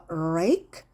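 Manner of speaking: rake with the tongue curled back hard; 혀를 활처럼 말아 rake 시범; curled R demo. Shown as a demonstration. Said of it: "혀를 이렇게 좀 말아요". (혀를 말아서 rake)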